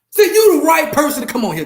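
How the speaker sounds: high, loud challenge; loud call-out. Real, not acted. Host calling out his guest Goldenfoot, loud and high. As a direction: call him out across the room.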